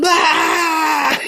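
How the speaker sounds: very high yelled burst; startled high shriek; startled scream. Retold or imitated. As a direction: shriek in sudden fright. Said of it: "we're all screaming".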